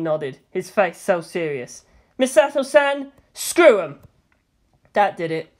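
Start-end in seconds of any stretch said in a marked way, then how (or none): 2.2-4.0 louder earnest defiance; earnest defiant call. A narrator's reading. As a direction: urge her boldly. Serious defiant teen.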